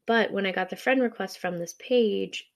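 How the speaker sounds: usual speaking voice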